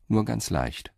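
ordinary talk

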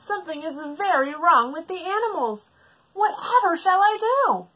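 high, loud panic; panicked keeper. Acted. Keeper in alarm over the animals. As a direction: fret wildly.

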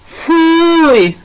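high, drawn-out exclamation of disgust; disgusted cry. A narrator's reading. Olivie reacts to dead mice as gifts, crying out in disgust. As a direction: cry out a long, loud yuck. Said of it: "vykřikla Olivie".

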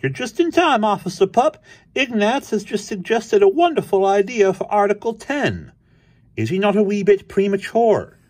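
very high, loud cartoon cat; Krazy Kat. A narrator's reading. Krazy greets Officer Pup with news, high voice. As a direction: gush it way up high.

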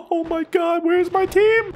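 high-pitched panicked whine; playful panic. Pretend. Gamer seeking his missing team, playful panic. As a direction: squeal for backup, half laughing. Alone under enemy attack.

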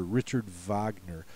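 Wagner said vahg-ner; German Wagner. Shown as a demonstration. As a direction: Richard Wagner with a V. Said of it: "most famous German opera composer".

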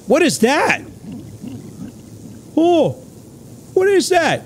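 high, squeaky shocked questions; startled disbelief. Real, not acted. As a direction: freak out at something weird. Spots something strange in game.

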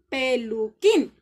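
peluquín, slow, with QUÍN loud and strong; stress on last syllable of peluquín. Shown as a demonstration. Teacher showing pupils the tonic syllable, peluquín stressed on QUÍN. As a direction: punch the final QUÍN in peluquín. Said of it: "la que suena más fuerte".

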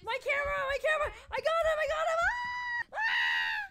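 very high frightened whimpering; high, frantic fearful babble; panicked squeal. Real, not acted. Panicking as ghost hunts.

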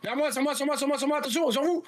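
high, urgent shouted callout. Real, not acted. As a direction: call them to you, fast and urgent. Urgent callout during firefight.

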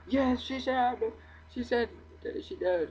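high, loud overjoyed shout; thrilled. Real, not acted. Gamer celebrating that the villager said yes, high and overjoyed. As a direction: celebrate like she just said yes.

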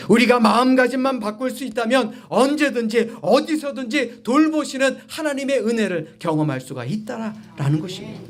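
high, insistent pulpit voice; emphatic preaching. Real, not acted. Preacher promising God's grace to congregation, emphatic preaching. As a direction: press the point on the congregation.

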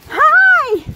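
excited high greeting. Real, not acted.

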